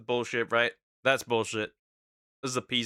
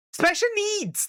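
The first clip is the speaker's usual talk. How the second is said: squeaky punchline. Real, not acted. Hesitant joke punchline.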